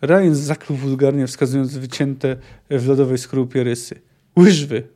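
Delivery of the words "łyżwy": sudden loud growl; one angry, forceful word. A narrator's reading. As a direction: growl it out through anger. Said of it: "warknął, zaciskając bezwiedne pięści".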